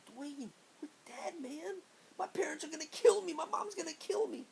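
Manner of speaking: high-pitched, desperate plea to a friend; retold panic. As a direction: whine in mock panic, very high. Retold panic after being arrested.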